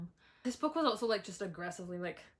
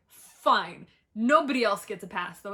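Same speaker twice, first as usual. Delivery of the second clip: loud exasperated; 10 dB louder than usual. Real, not acted.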